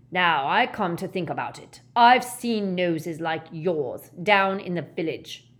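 loud, insinuating jibe. Acted. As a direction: aim to insult, loudly. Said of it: "supposed to be an offensive tone".

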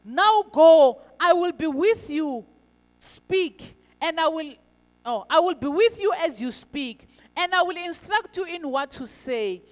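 loud, emphatic divine command. A narrator's reading. God commanding Moses in scripture reading.

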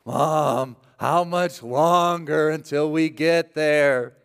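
slow drawn-out whining, high; whiny kid. Retold or imitated. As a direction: drag it out like a bored child.

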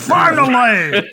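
high, drawn-out cry of relief; mock relieved shout; skinny guy. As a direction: stretch it out high, half laughing. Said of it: "becomes like a skinny guy".

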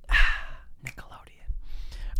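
whispered tag. Pretend. Announcer whisper joke.

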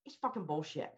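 put-on voice for a pet dog; dog's voice. Speaker voices her dog to mock work-life balance, put-on dog voice. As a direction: let the dog say it, pitched a little up. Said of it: "I have a voice for one of my dogs".

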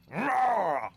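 loud, high dinosaur roar; monster roar. Acted. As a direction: roar like a beast. Goblin roars at the party.

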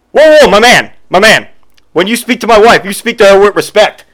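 high, loud whoa whoa, my man; hammed-up tough guy stepping in; mock macho husband. Husband butting in on the clerk, loud and high. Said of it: "he goes".

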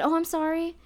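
chirped-up apology before the aside; reflexive apology. Retold or imitated. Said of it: "I actually stage whispered it".

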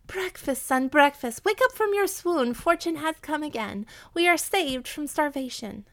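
high, loud joyful calling out; wild with joy. A narrator's reading. Widow wakes her son, shouting for joy, high and loud. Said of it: "wild with joy".

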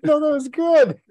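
gasping high laugh through the words. Real, not acted. Co-host breaking up over the botched intro, real laughter. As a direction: crack up and can't hold it.

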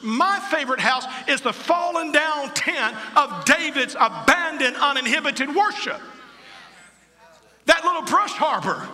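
high, loud revival preaching; fiery preaching. Real, not acted. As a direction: preach it out at full force. Preaching at full force.

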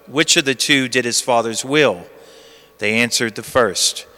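low, hushed Gospel reading; cathedral echo. Acted. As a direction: read the Gospel solemnly, like in church. Liturgical Gospel reading at the lectern.

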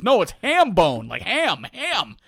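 mildly fed-up correction, hammering "ham"; frustrated. Retold or imitated. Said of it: "this guy's getting frustrated".

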